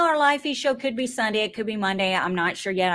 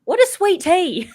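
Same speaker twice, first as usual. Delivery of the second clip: loud, higher incredulous question; baffled server; 9 semitones higher, 6 dB louder than usual. Retold or imitated. New York server baffled by sweet tea, mock incredulous. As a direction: imitate a server who cannot believe it.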